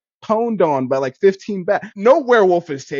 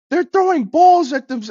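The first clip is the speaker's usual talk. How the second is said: put-on a brief, abandoned stab at a British accent, higher and louder; half-hearted British accent; 11 semitones higher, 5 dB louder than usual. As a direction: start a mock British accent, then give up. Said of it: "I'm not even gonna do a British voice".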